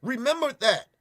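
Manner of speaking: normal talking voice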